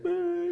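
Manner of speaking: very high, loud mock wail; one long piercing note imitating crying; imitated crying wail. New parent recounts baby's crying spell, imitated high wail. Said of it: "you know, you're crying".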